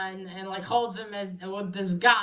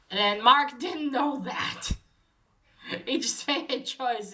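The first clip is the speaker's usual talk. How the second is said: chuckling through the words; amused laughter; 3 semitones higher than usual. Real, not acted. Amused narrator on Mark not knowing about the barrel, laughing. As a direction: let laughter break into the telling, pitch lifted. Amused at story while retelling it.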